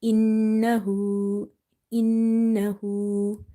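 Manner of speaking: slow "Innahu" with held n and long final u; stretched ending. Shown as a demonstration. Teacher showing inverted dhamma, stretched final vowel. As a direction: stretch the "hu" of "Innahu" one beat longer. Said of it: "you will stretch up to one alif".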